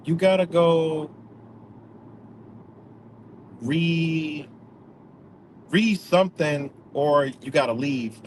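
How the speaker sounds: a slow, pushy order to leave; curt demand. Retold or imitated. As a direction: lay down the rule slowly, firmly. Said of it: "what, how she said? She said".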